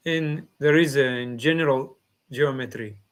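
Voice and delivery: normal talking voice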